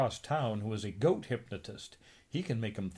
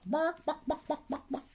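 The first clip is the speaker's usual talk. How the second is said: very high yappy barks; dog barking. Acted. Hypnotized Donald barking like a dog. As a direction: yap like a little dog.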